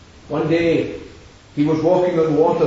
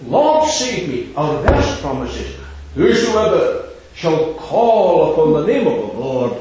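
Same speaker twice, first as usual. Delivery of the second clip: preaching at full force; 6 semitones higher, 5 dB louder than usual. Acted. Preacher to the meeting, urging them to call on the Lord, full-force proclamation. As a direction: hammer home the call to the Lord.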